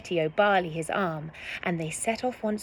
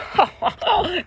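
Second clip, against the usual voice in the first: high, loud boastful laugh; hearty laugh; 11 semitones higher, 4 dB louder than usual. A narrator's reading. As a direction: laugh him off. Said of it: "Reginald laughed".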